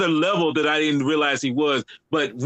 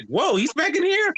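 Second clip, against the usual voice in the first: surprised; 11 semitones higher than usual. Retold or imitated. Retelling own surprise at return.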